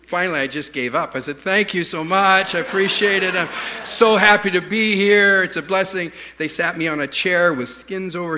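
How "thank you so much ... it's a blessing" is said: louder, raised-pitch public thanks; retold grateful acceptance. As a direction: gush thanks to a crowd, acted. Retold giving in, thanking crowd.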